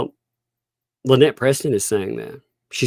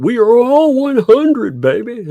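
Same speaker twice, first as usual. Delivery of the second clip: high, louder hype shout; excited cheer; 14 semitones higher, 6 dB louder than usual. Real, not acted. Streamer celebrating goal with chat, excited cheer.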